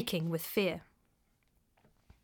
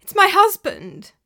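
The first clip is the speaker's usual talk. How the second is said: high, loud trembling plea; frightened; 10 semitones higher, 9 dB louder than usual. Acted. Weeping widow telling the prior about her husband, high and loud. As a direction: blurt it out in fear.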